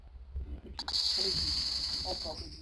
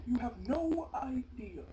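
ordinary talk, nothing marked

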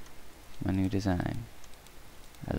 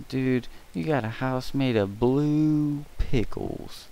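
slow, louder drawn-out dude voice; impressed friend; 6 semitones higher, 4 dB louder, slower than usual. Retold or imitated. Imagined friend gawks at the pickle house, slow loud amazement. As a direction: act an amazed buddy. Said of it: "They'd be like".

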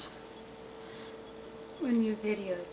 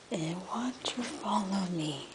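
whisper; 2 semitones lower, 6 dB quieter than usual. Real, not acted. Whispering not to disturb cat.

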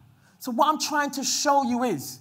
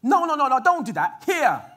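louder, pleading refusal; desperate protest; 2 semitones higher, 5 dB louder than usual. Retold or imitated. Lot begging the crowd again, loud protest. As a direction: hold back the mob. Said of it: "Remember what I'm saying about Lot now".

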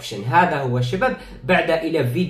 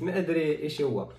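ordinary talk, nothing marked

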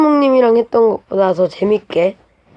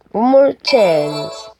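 slow drawn out; much slower than usual. Acted. Game reaction, drawn out.

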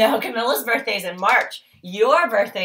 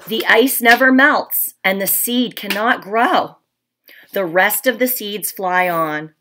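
storybook read-aloud, a little louder, with hushed asides; reading to children; 5 dB louder than usual. Acted. Teacher reading The Tiny Seed to her class. Reads picture book to class.

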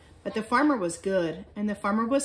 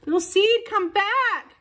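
high, loud calling after a friend; crying out; 11 semitones higher, 5 dB louder, slower than usual. A narrator's reading. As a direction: call out desperately, high and loud. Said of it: "cried Little Fox".

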